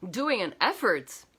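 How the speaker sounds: ordinary delivery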